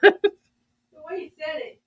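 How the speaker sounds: slow words through laughter; laughing. Real, not acted. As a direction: laugh while you say it.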